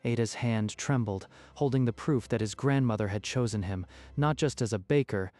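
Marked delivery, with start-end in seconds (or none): none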